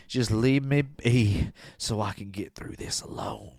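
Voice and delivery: soft, slow, breathless whisper; whispered plea. Retold or imitated. Doug begging to be left alone, whispered plea. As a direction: whisper it like a heartbroken man. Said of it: "he said".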